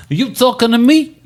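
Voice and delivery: a hammed-up bad De Niro; De Niro impression. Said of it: "you sort of morph into Robert De Niro".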